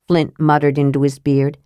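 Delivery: plain reading voice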